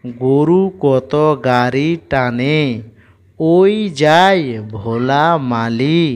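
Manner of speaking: very slow, word by word poem reading; slow rhythmic recitation of a children's rhyme; slow reading aloud. Shown as a demonstration. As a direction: read it out slowly for children to follow. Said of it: "উচ্চারণ করবার চেষ্টা করব".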